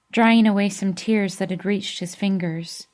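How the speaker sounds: usual speaking voice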